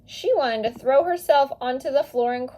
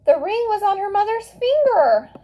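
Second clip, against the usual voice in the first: sudden gasp, then higher and louder; gasping surprise; 9 semitones higher, 5 dB louder than usual. A narrator's reading. Narrator gasps as Maria spots the ring, surprised. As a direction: breathe it out in shock at the sight.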